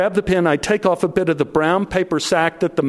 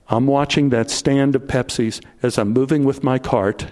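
usual speaking voice

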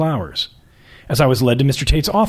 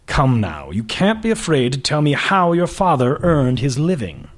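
put-on upper-class prep school and British accent; lofty aristocrat sizing up a young woman; aristocratic accent. Magazine boss greeting a job candidate, aristocratic accent. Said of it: "His accent was patently aristocratic".

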